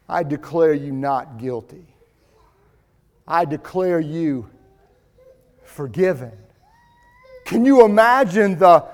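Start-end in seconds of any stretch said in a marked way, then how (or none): 0.1-6.3 judge's soft verdict. Acted. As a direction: pronounce the pardon gently, almost hushed. Judge pronounces verdict softly.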